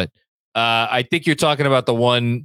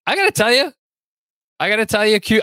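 excited; 9 semitones higher, faster than usual. Real, not acted. Excited lead-in.

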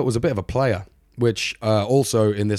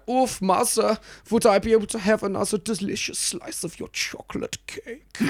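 little Fritz; 11 semitones higher than usual. Retold or imitated. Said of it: "So little Fritz would be like".

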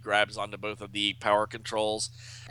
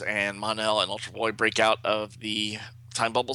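plain reading voice

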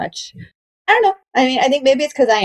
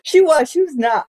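ordinary delivery

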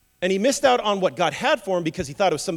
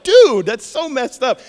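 loud, drawn-out disbelief; a slow, loud "dude" in mock outrage; incredulous outburst; 7 semitones higher, 9 dB louder, slower than usual. Reacting to Jacob's family order.

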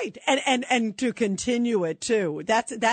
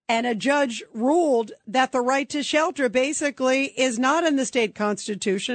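ordinary delivery

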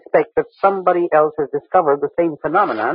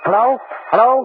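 calling out; 9 semitones higher than usual. Acted. Henry calls into the transmitter.

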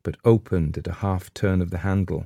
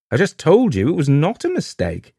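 raised, cheeky insistence, slightly louder; insolent; 9 semitones higher, 4 dB louder than usual. A narrator's reading. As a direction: throw it back at her with insolence. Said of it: "said Missus Pascoe insolently".

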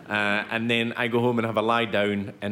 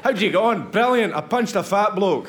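high, loud, triumphant exchange; elated boasting; 8 semitones higher, 5 dB louder than usual. Acted. Comic acting out a proud Scottish puncher, elated. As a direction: play it jubilant, pitched right up.

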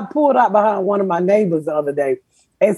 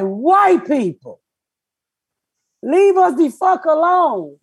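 higher, slow put-upon plea; exasperated mock plea; 7 semitones higher, slower than usual. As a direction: act out begging them to leave you alone. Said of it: "And I'm like".

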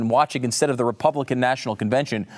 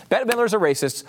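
mock outrage; 8 semitones higher than usual. Shown as a demonstration. Host mocking the outrage at [name], high and scandalised. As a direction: squeal it like a faked scandal.

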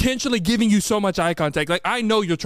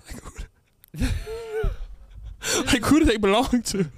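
laughing; 5 semitones higher, much slower than usual. Real, not acted. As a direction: question broken by laughter. Laughing mid-question.

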